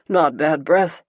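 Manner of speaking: a hammed-up gasp of horror on breath; clutching at mock outrage. A narrator's reading. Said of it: "he gasps".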